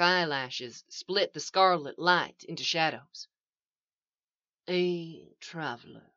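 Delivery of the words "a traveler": soft, drawn-out answer; slowly. A narrator's reading. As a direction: take your time, quietly. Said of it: "said Thrawn slowly".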